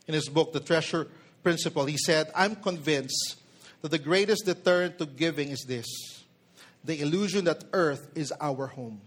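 ordinary talk, nothing marked

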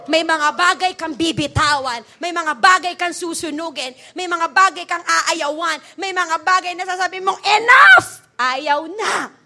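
loud, driving repeated declarations; full-force preaching. Acted. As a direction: build to a shouted refusal.

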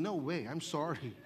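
No way, I'm sorry dropped low and soft; a low, soft aside turning it down; quiet refusal. Acted. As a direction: mutter the refusal under your breath. Same refusal said again quietly.

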